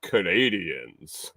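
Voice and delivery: drawn-out, slow delivery; retold punchline. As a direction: stretch the word out slowly, quoting her. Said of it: "she goes".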